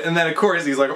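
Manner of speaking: usual speaking voice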